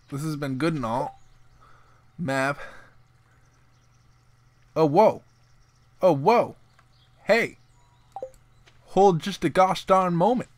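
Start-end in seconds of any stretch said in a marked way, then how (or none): 4.7-6.6 loud startled oh whoa; surprised whoa. Real, not acted. Spotting something in the game. 7.2-10.5 loud, higher hey, hold on; indignant halt. Real, not acted. Stopping the game character abruptly.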